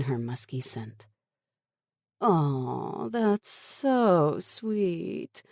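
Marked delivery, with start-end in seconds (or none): 2.2-5.4 slow, drawn-out teasing coo; mock sweet. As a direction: gush over them sarcastically. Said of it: "fluttering her eyelashes".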